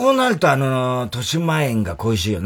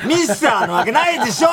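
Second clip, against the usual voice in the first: laughing shout; 11 semitones higher than usual. Real, not acted. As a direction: yell it while cracking up. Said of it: "お前が一番ひどいんだよ".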